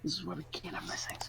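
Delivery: hushed, whispery grumble; grumbling under breath. Real, not acted. Player lamenting a bad initiative roll, whispered grumble. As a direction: grumble it very softly to yourself.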